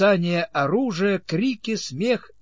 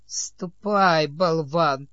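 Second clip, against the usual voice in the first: snide hiss; 3 dB louder, much slower than usual. A narrator's reading. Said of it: "ехидно прошипел он".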